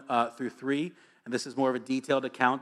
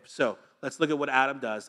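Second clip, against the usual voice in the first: ordinary talk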